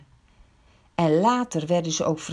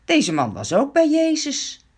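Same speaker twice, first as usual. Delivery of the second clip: higher and louder, stress on bij; second maid accusing; 6 semitones higher, 4 dB louder than usual. A narrator's reading. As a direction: tell the crowd, accusing. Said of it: "ander dienstmeisje ... zegt".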